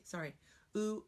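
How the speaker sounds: ordinary talk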